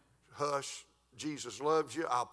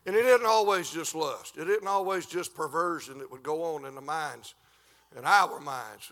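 preaching; 3 semitones higher, 10 dB louder than usual. Acted. Preacher making points to congregation.

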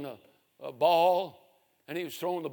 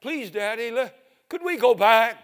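put-on higher child's voice, begging; pleading little boy; 5 semitones higher, 6 dB louder than usual. Little boy begs his father.

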